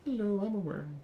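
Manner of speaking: high squeaky little worm. Retold or imitated. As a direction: voice a silly cartoon worm. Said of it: "are the worm going".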